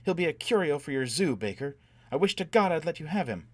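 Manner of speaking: high-pitched angry tirade; furious threat. A narrator's reading. Furious threats against Foyle.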